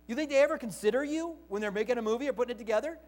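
ordinary talk

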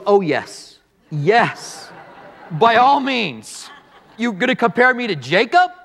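drawn-out scoffing reply, rising in pitch at the end; mock incredulity. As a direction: act out amused, sarcastic disbelief at the comparison.

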